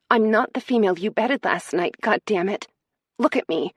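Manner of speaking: a hurt woman shouting at her lover; anguished cry. Acted. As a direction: cry it out from a broken heart. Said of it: "She cried out".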